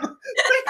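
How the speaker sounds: light high laugh; amused laugh. Real, not acted. Host laughing at her son's tears, light high laugh. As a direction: laugh at the absurdity.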